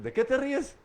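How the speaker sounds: high, loud, laughing tease; laughing quote. Retold or imitated. Friends tease him about his smile, laughing loudly. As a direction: toss the question out through a laugh.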